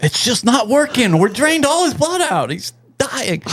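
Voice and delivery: high-pitched, hammed-up alarm; mock panic. Doctor seeing his bloodletting fail, high-pitched mock panic. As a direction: play a quack doctor in over-the-top distress.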